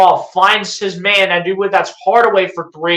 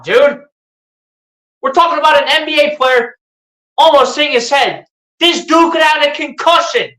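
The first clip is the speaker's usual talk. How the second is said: outraged complaint; 6 semitones higher than usual. Real, not acted. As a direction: protest like the refs just robbed you. Outraged at the late whistle.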